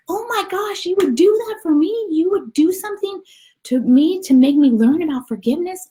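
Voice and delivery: put-on very high, loud childlike astonishment; amazed little soul. Little soul marvels at the other's offer, amazed and squeaky. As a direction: gush in squeaky, overjoyed disbelief. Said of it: "the little soul's like".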